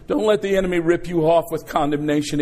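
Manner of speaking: ordinary talk, nothing marked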